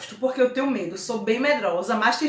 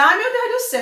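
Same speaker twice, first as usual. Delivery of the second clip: high, louder mock wail; imitated dismay; 11 semitones higher, 5 dB louder, much faster than usual. Teacher imitates people complaining the words are hard, mock dismay. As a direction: play a student overwhelmed by hard words. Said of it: "Aí tem pessoas que dizem".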